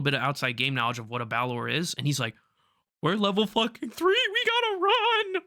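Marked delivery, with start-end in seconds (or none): 3.0-5.4 panicked. Retold or imitated. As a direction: yelp it like the monster is right there. Player freaking out over Balor.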